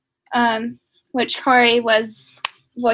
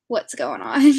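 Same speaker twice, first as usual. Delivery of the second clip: usual speaking voice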